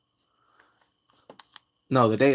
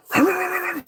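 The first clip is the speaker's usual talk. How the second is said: high laugh. Real, not acted. Laughs at own joke.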